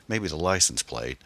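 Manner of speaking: ordinary delivery, nothing marked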